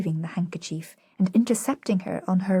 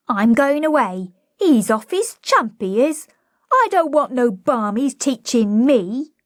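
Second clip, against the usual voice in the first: put-on loud, higher cockney girl standing up for herself; a cockney girl declaring she is leaving; louder cockney girl pushing back; defiant cockney flower girl; 6 semitones higher, 7 dB louder than usual. A narrator's reading. Liza voiced in cockney.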